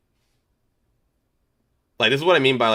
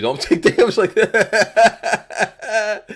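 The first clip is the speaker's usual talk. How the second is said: high laughing plea, slow; words broken by laughter; 11 semitones higher, 7 dB louder, much slower than usual. Real, not acted. Laughing at player's damage.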